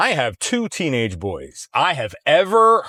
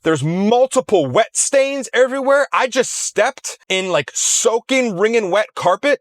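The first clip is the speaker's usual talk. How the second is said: higher-pitched, outraged retelling of the son; imitating furious son; 8 semitones higher than usual. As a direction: play the livid young seller on the phone. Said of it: "pissed off as this young man was".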